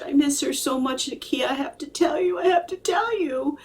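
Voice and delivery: his voice cracking into a sob; imitating crying widower. Psychic voicing a widower crying over his wife, a sob breaking through.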